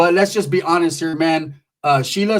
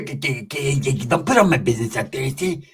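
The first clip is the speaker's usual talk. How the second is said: low, softer stammered impression; Pepe; 4 semitones lower, 4 dB quieter than usual. Telling her not to air his business, low imitation. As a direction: mimic him in a low, halting mutter. Voicing Pepe, the man inside.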